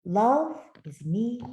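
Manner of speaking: ordinary delivery, nothing marked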